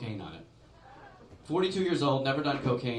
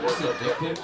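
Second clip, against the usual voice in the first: higher, louder shocked question; 6 semitones higher, 6 dB louder than usual. Retold or imitated. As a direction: sound scandalized. Shocked high loud reaction.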